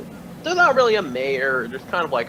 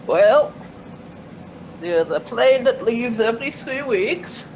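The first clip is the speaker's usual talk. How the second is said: put-on old Irish mayor voice; 8 semitones higher than usual. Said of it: "squares up his glasses ... and says".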